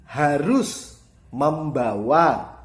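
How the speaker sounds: "harus membawa" very slow, high and loud; strong emphasis. Shown as a demonstration. Teacher gives example of emphasis, "harus membawa" loud and drawn out. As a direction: hammer "harus", then "membawa", loudly and slowly. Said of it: "itu contoh penekanan".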